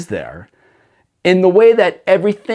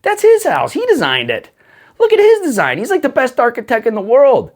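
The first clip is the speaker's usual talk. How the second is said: excited and much higher on "That's his house"; awestruck gush; 9 semitones higher than usual. Retold or imitated. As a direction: gush about the mountain as God's design. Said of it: "you're just like".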